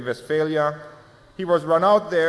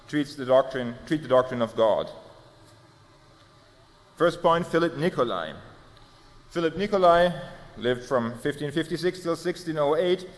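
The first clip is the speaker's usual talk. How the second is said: normal talking voice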